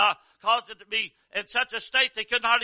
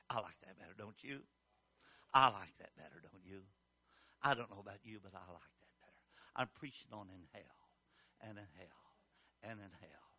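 hushed aside; 13 semitones lower, 19 dB quieter than usual. Real, not acted. Quiet reflective aside.

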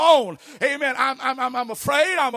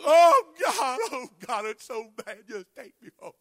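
mock despair; 3 dB quieter than usual. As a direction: fade into a pitiful whimper. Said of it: "I mean, go".

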